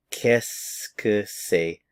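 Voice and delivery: very slow, each syllable laid out, Qu'est-ce que c'est run into kess-kuh-say; slow French demo. Shown as a demonstration. Teacher slowing Qu'est-ce que c'est for students. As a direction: drawl out Qu'est-ce que c'est slowly for learners. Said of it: "Listen to the pronunciation".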